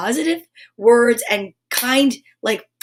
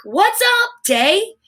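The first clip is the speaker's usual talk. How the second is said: cheerful greeting; 8 semitones higher, much slower than usual. Retold or imitated. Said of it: "it is like".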